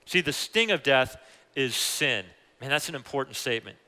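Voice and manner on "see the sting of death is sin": usual speaking voice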